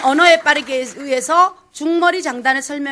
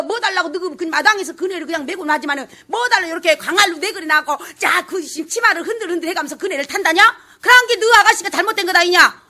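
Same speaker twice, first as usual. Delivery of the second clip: loud, high, quick Jeolla scolding banter; 전라도 사투리로 빠르게 수다; Jeolla dialect Chunhyangga talk; 4 semitones higher, 3 dB louder than usual. Retold or imitated. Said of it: "이 말들 빠르기가 전라도 말이에요".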